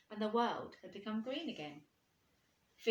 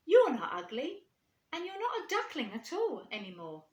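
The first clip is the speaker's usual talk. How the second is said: reassuring swans; 6 semitones higher, 7 dB louder than usual. Acted.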